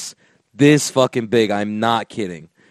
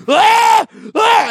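short bursts of high, loud screaming; imitated screams; 6 dB louder than usual. Mimicking the kid as his mom pretends to understand, high loud screams. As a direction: mimic two high-pitched yells. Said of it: "screaming louder than".

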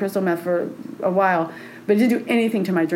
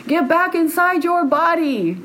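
high, drawn-out mock holler; husband calling her back; 8 semitones higher, slower than usual. Husband summoning wife back into her body, high urgent call. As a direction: call someone back from far away, pitched high. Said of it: "I hear my husband's voice going".

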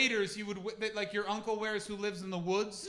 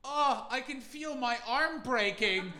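slow, louder mock whine; whiny quitter; 5 semitones higher, 10 dB louder, slower than usual. The snake man calling it off, slow, whining and loud. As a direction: whine like a wimp giving up. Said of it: "And then he went".

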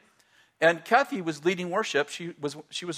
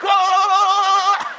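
sudden yell; 14 semitones higher, 8 dB louder than usual. Retold or imitated. Tom shouting on the floor.

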